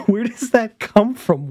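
loud, high, slow, through laughter; laughing to tears. Real, not acted. Reacting to the lemur claim, laughing hard. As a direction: squeeze the question out while cracking up. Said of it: "I'm sorry, I'm crying".

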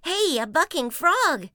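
high, loud cub call; excited boy shout. Acted. Brother spotting the frog ride, high excited shout. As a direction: shout like a thrilled young cub. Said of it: "shouted Brother".

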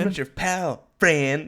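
1 South Park quote. Retold or imitated. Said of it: "South Park".

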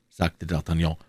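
ordinary delivery, nothing marked

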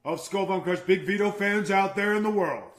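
loud showman addressing his legions of fans; borrowed catchphrase gimmick. Acted. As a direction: proclaim it to the arena. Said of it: "That's someone else's gimmick".

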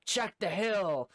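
high, drawn-out holler; imitated calling out. Somebody in the search party to the others, imitated long holler. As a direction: call it slowly across a distance.

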